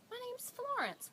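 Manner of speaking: high-pitched imitation of a woman answering a child; lightly voiced lady, lifted high; friendly woman. Woman introducing herself to a three-year-old, high voice.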